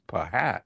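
"pahat" in two syllables, puh-HAT; mistaken spelling pronunciation. Shown as a demonstration. Linguist showing how nobody reads the slang, "pahat" as puh-HAT. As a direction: sound the p and h separately in "pahat". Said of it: "thought that it was pronounced".

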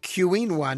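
quinoa said letter by letter as "kyoo-EEN-wah"; wrong quinoa, starting with a "kyoo" sound; mispronounced quinoa. Shown as a demonstration. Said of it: "if you pronounce it".

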